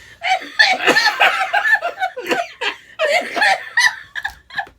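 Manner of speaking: long, loud, high laughing fit; really cracking up, nearly crying; helpless laughter. Real, not acted. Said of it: "this is how bad I was laughing".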